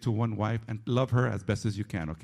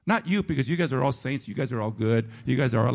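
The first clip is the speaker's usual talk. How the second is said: ordinary delivery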